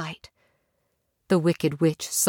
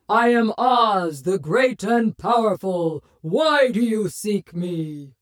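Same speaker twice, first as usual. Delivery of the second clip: giant alien booming voice; slower than usual. A narrator's reading. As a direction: boom it out like a mighty wizard. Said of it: "they heard a booming voice say".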